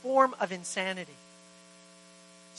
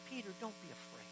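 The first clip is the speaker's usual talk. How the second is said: very soft, tender reassurance; gentle comfort; 4 semitones lower, 21 dB quieter than usual. Retold or imitated. Jesus comforting a frightened Peter, very soft and tender. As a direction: hush him like a scared friend.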